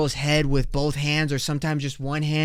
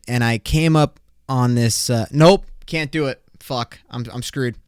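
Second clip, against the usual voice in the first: ordinary delivery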